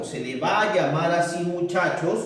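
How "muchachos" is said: normal talking voice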